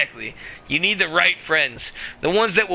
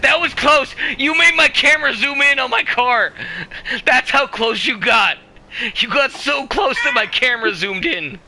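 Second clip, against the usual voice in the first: excited shouting; 7 semitones higher, 3 dB louder than usual. Real, not acted. Excited near miss.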